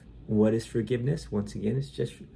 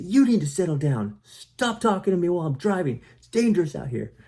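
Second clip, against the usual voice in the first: high, loud irritated retort; angry driver snapping at his passenger; angry snap back; 8 semitones higher, 3 dB louder than usual. Acted. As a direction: throw the words back at the passenger. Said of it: "And then you'd say".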